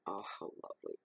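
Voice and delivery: usual speaking voice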